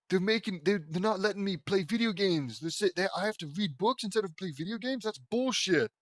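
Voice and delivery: high-pitched pretend indignant rant; mock outrage. Reader imagines being denied video games, in mock outrage. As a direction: protest like a wronged gamer, playing it up. Imagined protest about forced reading.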